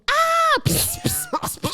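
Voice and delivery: sparkler fizz. Retold or imitated. Mimics sparkler fizzing.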